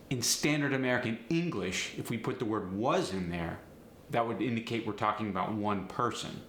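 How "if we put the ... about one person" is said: usual speaking voice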